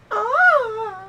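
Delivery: very high, loud "ah"; mimicked squealing cry; imitated kid's yell. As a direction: burst out with a high goofy holler. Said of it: "And he's like".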